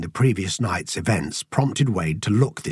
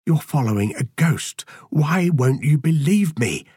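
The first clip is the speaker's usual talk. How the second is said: higher, drawn-out plea; innocent pleading; 7 semitones higher than usual. A narrator's reading. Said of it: "Andrew queried innocently".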